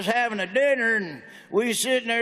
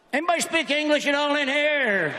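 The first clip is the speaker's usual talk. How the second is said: high, exasperated appeal to the room; exasperated call-out; 5 semitones higher than usual. Real, not acted. Comedian to an unresponsive audience, exasperated high call-out. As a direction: call out to the crowd, pitched up.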